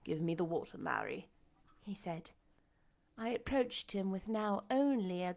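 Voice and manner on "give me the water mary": lightly voiced Rochester, subdued; weary blind master. A narrator's reading. Blind master asking his servant for water, subdued. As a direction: lower voice, falling away quietly.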